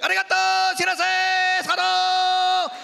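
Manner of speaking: high, loud fake Japanese shouting; mock Japanese yelling. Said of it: "they just start yelling at me".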